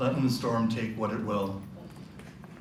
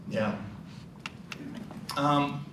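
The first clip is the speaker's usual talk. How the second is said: normal talking voice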